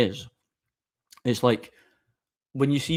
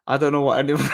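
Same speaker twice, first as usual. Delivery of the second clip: laughing; 3 semitones higher, 4 dB louder, faster than usual. Real, not acted. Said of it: "I just find that funny".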